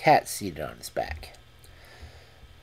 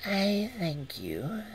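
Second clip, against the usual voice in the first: slow, breathy cat whisper; 13 semitones higher, 4 dB quieter, much slower than usual. Acted. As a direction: whisper it slyly and slowly.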